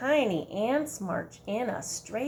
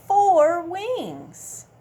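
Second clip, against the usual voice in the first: excited count; 7 semitones higher, 9 dB louder than usual. Acted.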